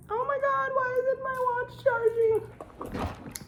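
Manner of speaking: very high, drawn-out squeal of dismay; alarmed outburst. Real, not acted. Vlogger interrupting herself over an uncharged watch, high dismayed squeal. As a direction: squeal it high and slow, thrown off track.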